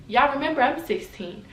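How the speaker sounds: usual speaking voice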